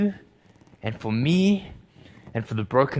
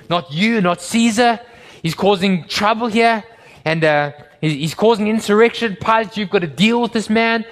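loud, higher, pressing accusation; urgent accusers; 7 semitones higher, 4 dB louder than usual. Acted. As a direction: push Pilate hard, like a mob's spokesman. Accusers pleading to Pilate.

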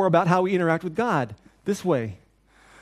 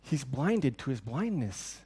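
hushed aside; 4 semitones lower, 7 dB quieter, much slower than usual. Retold or imitated. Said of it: "they go".

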